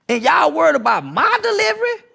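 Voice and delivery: indignant shout. Real, not acted. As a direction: throw the question back at them, loud. Repeated question, louder, higher.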